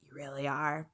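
whispered confidentially; on the low. Retold or imitated. Grandpa whispering to granddaughter, barely audible. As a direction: let it slip under your breath. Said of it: "on the low, he'll be like".

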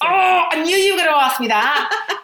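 high, loud, laughing protest; real amused indignation, pitched right up; mock-outraged protest. Real, not acted. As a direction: squeal back at the teasing. Protests at being teased as passive aggressive.

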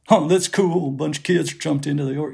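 a rough attempt at an Athens drawl. Retelling what the band said on tape, in a mock Athens drawl. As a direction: imitate an Athens drawl, loosely. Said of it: "Athens drawl, which I can't do".

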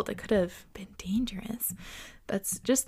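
soft hushed whisper. Real, not acted. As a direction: breathe it quietly. Hushed aside.

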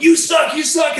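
high, loud mock crowd shout; heckling yell. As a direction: jeer like a fan in the stands. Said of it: "people yelling in, at me from the crowd, heckling".

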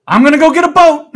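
high, loud resigned announcement; ranger yelling. Retold or imitated. Ranger shouts his plan across the river. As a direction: call it over the river.

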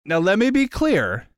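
wide-eyed, dazed and amazed; tripping on drugs. Pretend. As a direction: gasp at the hallucinations. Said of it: "I am tripping balls right now".

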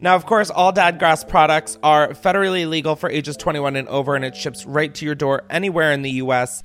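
upbeat podcast ad read. Acted. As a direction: sell the sponsor, casual and playful. Reads a sponsor ad.

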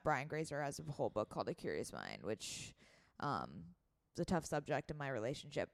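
low creaky vocal fry trailing off. Real, not acted. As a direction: let the voice sink into creak. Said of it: "vocal fry".

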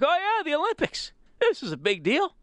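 high, perky imitation of a listener; mock excitement. Host imagines Brian finding the opening ceremonies, hammed-up eager voice. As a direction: ham up a fan's sudden interest. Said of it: "he's like".

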